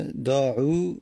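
"داعون" very slow, split into separate sounds; sounded out. Shown as a demonstration. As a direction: stretch "داعون" out piece by piece.

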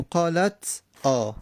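قالت آ split as "qālat, ā"; unlinked silent t. Shown as a demonstration. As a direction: stop on the t of قالت, then a separate آ. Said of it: "تا ساکنه".

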